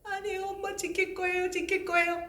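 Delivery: put-on pleading child. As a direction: beg mother in a small child voice. Child pleads with scolding mother.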